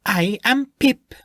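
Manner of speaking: put-on high, slow, faint Spanish accent; light Spanish accent. The gnome introduces herself, faint Spanish accent. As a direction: announce it with dignity in a hint of Spanish. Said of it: "her Spanish accent more clearly heard this time".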